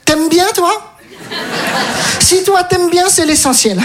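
mother's reply. Retold or imitated. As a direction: lift it up, falsely gracious. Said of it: "elle fait".

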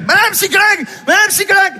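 put-on a boy calling for his therapist. Enfant appelle la psy. (enfant qui appelle, aigu et fort)

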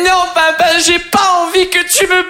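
high, slow tearful plea; imitated crying child. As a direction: sob it out like a small daughter. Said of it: "elle commence à pleurer".

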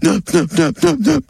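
squealing pig counting in oinks. Acted. A farmer's pig oinking its answer. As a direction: grunt the numbers like a pig.

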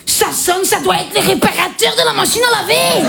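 high, hammed-up Belgian accent; high-pitched, exaggerated Belgian-accented come-on; Belgian porn parody. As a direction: play a Belgian porn actress with a broad accent. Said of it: "T'as déjà vu un porno belge?".

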